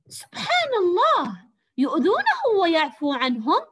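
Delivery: put-on high, loud, slowed boyish voice for the son; voiced boy Fawaz. Shown as a demonstration. Teacher reading Fawaz's line to pupils, high and loud. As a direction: read the son's amazed question with colour. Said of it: "أن ألون صوتي وفق ما يقتضي المعنى".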